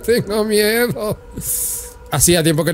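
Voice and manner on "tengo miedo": high, slow whimpering scared voice; hammed-up fear, drawn out; mock scared.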